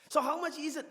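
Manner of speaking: high, loud questioning passer-by; curious market visitor. Acted. Preacher voices a market visitor at the gazebo, high and loud. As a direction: call out like a curious stranger at the stall.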